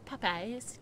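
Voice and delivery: ordinary delivery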